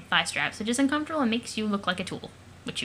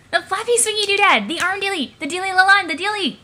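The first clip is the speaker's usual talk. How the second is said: high, loud, urgent blurting; worked-up, high-pitched insistence; frantic fumbling excitement; 9 semitones higher, 7 dB louder than usual. Acted. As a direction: flail excitedly for the word. Excited character fumbling for word.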